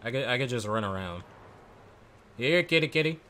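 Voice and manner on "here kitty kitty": higher, louder sing-song cat call; coaxing a kitty. Pretend. Luring a zombie like a cat.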